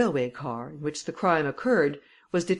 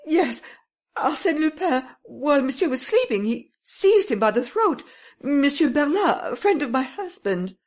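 much higher, louder, tearful pleading; weeping lady; 10 semitones higher, 5 dB louder than usual. Acted. Lady weeping to the commissary, high and loud. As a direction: sob the story to the police. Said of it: "Then she continued weeping".

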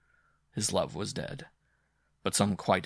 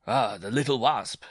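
louder, higher rider's exclamation; crying out; 4 semitones higher, 5 dB louder than usual. A narrator's reading. Bareback rider grabbing the dwarf, crying out. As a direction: call it out at raised pitch and volume. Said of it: "the bareback rider cried".

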